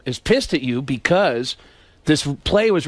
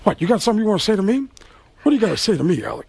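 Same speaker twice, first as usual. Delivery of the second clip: fast, challenging Pacino bark; Al Pacino impression; 4 semitones higher, much faster than usual. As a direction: snap it back like Pacino. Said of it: "he says".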